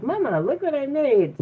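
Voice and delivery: put-on high, sing-song little kid voice; proud little-girl squeal, imitated; imitating excited daughter. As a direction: pitch it up like a child showing off. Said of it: "when she's like".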